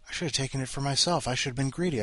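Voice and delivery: usual speaking voice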